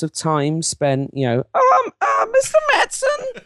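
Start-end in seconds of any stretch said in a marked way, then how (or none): 1.5-3.4 very high, nerdy fan voice; hammed-up timid fan at a convention microphone; BlizzCon attendee voice. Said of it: "Your BlizzCon attendee voice".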